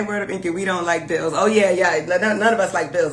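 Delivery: normal talking voice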